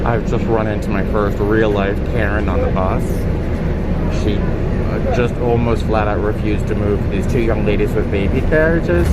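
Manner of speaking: loud, agitated report from the bus; shaken, loud and angry; upset and loud. Real, not acted. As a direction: vent loudly about a scene you just saw. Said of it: "I'm so mad".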